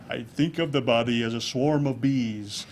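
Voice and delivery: slow, hushed soothing guru tone. Retold or imitated. Speaker imitating Deepak Chopra's soothing voice, slow and lulling. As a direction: lull them like Deepak Chopra. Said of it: "He just has this soothing voice".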